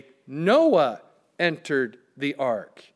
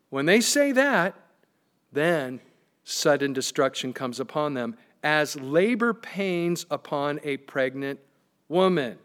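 ordinary delivery

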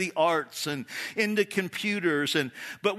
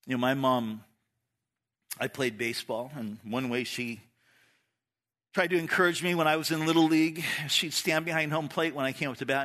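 usual speaking voice

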